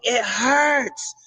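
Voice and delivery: high-pitched mock cry of pain; imitated yell. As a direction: imitate yelling in pain without real volume.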